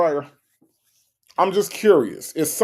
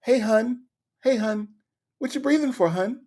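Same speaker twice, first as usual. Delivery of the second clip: high-pitched, cooing mock query; imitating Trina; 9 semitones higher than usual. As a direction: put on a high voice, coaxing your hun. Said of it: "Trina too said".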